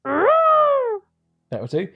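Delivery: high drawn-out klaxon blare; klaxon noise. Pretend. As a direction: blast it like an alarm. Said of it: "can you do your noise again".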